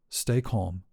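ordinary talk, nothing marked

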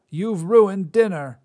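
put-on high, loud cranky old woman; old Drea. Old witch scolds the god who ruined dinner, high and loud. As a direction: snap at him like a crotchety crone.

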